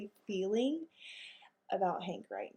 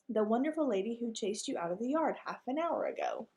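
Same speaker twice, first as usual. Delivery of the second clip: high, sugary, pointed lady dog voice; sweetly scolding like a proper lady; prim lady dog; 3 semitones higher than usual. Acted.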